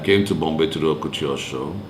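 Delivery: ordinary talk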